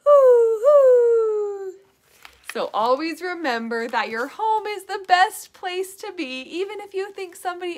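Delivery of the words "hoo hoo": loud, high, drawn-out hoots; imitated owl call. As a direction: hoot proudly like a storybook owl. Owl hoots end the story.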